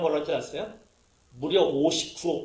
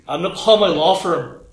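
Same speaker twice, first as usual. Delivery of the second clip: threat; 9 dB louder, much slower than usual. Retold or imitated. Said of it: "생각하면서".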